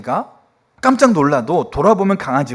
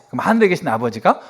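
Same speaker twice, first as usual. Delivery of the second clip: ordinary talk, nothing marked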